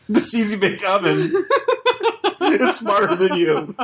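words broken up by real laughter; laughing. Real, not acted. Riffing on an oven outsmarting the hero, laughing. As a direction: push the punchline out between giggles. Cracking up while riffing on toys.

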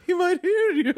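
high fussy lady voice; high mocking imitation of the hushing woman; Emily impression. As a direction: pitch it up like a startled matron. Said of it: "love your Emily impression".

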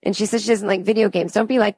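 ordinary talk, nothing marked

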